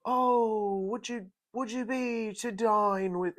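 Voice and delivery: high, loud hammed-up Shakespearean hype; mock Shakespearean accent. Podcaster mocking the host's food hype in mock Shakespearean. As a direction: overdo a lofty Shakespeare accent, drawn out. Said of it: "he was doing, like, Shakespeare or something".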